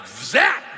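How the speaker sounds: loud sudden "zap" strike; shouted zap. Acted. Imitates being struck dead mid-sentence.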